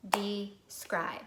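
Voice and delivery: "Describe" split as dee, pause, scribe; clapped syllables. Shown as a demonstration. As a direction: clap out "Describe" in two beats. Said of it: "Let's clap it".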